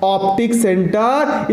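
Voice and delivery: Optic centre said slowly, word by word; slow emphasis. Shown as a demonstration. Teacher slowly naming the term for his students.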